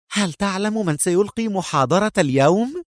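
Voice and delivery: high, keen confiding question; eager. A narrator's reading. Cadet leaning to his classmate with news, eager and high. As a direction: share news eagerly, no real whisper. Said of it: "يهمس في لهفة".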